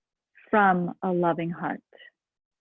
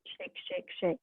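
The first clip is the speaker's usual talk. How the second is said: whispered shake; 15 dB quieter than usual. Acted. Shaking exercise in meditation.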